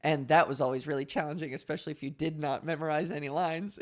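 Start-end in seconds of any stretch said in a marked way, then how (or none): none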